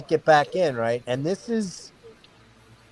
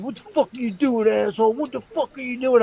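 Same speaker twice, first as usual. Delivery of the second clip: put-on angry drunk; 8 semitones higher than usual. Drunk brother angry in van.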